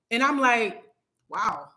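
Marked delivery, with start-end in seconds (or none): 1.3-1.7 low, soft wow; quietly taken aback, voice dropped; understated wow. Retold or imitated. Retold stunned reaction.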